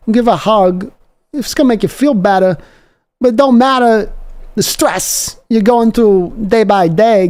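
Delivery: slow, high, drawn-out rallying cry to the faithful; fired-up fan pep talk. Acted. Host rallying anxious Seahawks fans before the win, fired-up slow preaching. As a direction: preach it to the fans like a hype man.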